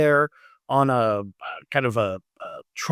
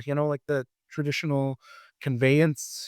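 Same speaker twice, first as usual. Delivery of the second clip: usual speaking voice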